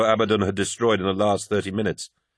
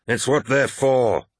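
higher, drawn-out irritated retort; snapped; 5 semitones higher, 3 dB louder, slower than usual. A narrator's reading. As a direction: snap back at a comrade, a touch louder. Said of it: "snapped Abaddon".

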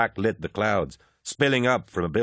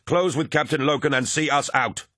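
raised, high battle command; yelled order; 7 semitones higher, 3 dB louder than usual. A narrator's reading. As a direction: yell orders to the squad while firing. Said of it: "Torgadden yelled, firing".

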